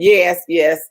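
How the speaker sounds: ordinary talk, nothing marked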